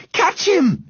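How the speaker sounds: high-pitched urgent yell. A narrator's reading. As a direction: yell to a friend to catch the dog. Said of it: "yelled Henry".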